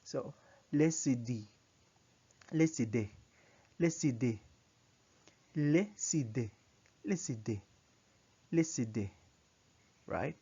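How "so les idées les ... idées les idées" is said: les idées run together as one word, S flowing into idées; liaison drill. Shown as a demonstration. Teacher repeating liaison for students, connected pronunciation. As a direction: repeat les idées smoothly, linking the consonant. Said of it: "you connect the sounds".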